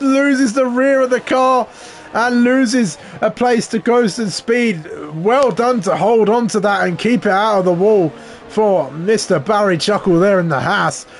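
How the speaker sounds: high, loud urgent race call; excited commentary. Acted. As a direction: shout the save as it happens. Car slides, live race call.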